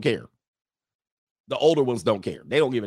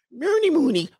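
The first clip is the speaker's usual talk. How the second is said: very high singsong Muppet babble, laughing; Swedish Chef. Retold or imitated. Imitating the Muppets' cook for laughs, very high singsong babble. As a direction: pitch way up into silly mock-Swedish gibberish. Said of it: "the Swedish chef from the Muppets".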